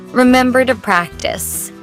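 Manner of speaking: ordinary talk, nothing marked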